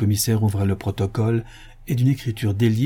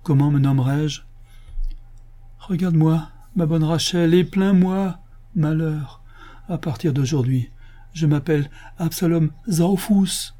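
lament; 7 semitones higher than usual. A narrator's reading. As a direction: cry out for pity to his wife. Absalom se lamente à sa femme. (lamentation aiguë et désespérée)

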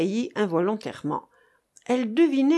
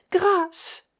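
high-pitched desperate cry; plea for mercy; 11 semitones higher, 3 dB louder than usual. A narrator's reading. Captive mother falling to her knees, pleading cry. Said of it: "fit Hermosa en se laissant tomber à genoux".

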